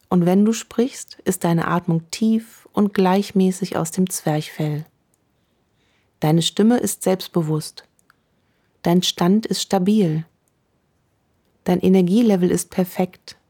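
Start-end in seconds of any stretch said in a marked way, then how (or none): none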